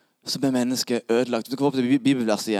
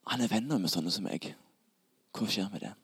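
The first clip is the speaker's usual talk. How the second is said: soft, low, puzzled musing; hushed wondering; 5 semitones lower, 12 dB quieter than usual. Acted. Tax collector wondering about Jesus, hushed. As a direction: think it aloud quietly, to yourself.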